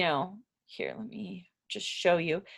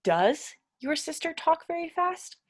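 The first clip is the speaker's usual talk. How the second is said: higher question, "fast" rising; 8 semitones higher than usual. Shown as a demonstration. Teacher modelling a question for learners in a lifted voice. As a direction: ask it up high, like a real question. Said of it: "to turn it into a question".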